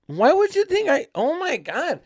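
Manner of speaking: high, drawn-out whiny protest; hammed-up wail of innocence; mock crybaby. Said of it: "I was freaking Mister Crybaby".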